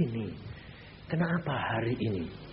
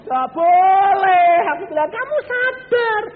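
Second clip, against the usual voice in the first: loud rebuke; 14 dB louder than usual. Acted. Preacher thunders a correction at the congregation. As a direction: drag out the rebuke.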